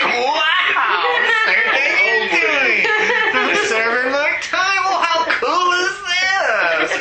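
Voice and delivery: loud, high, laughing and slow; laughing reply. Retold or imitated. He answers old friends on the food line, laughing loudly.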